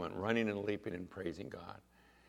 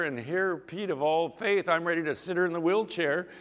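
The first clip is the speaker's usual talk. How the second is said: ordinary talk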